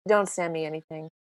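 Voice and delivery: low, soft friend on the phone saying no newsletters; gruff friend. Retold or imitated. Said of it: "he calls me, he goes".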